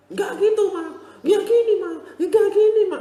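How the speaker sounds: very high, loud shouting at mum; child yelling back. Retold or imitated. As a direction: scream it back like a defiant kid. Anak balas berteriak ke ibu. (anak berteriak balik ke mama)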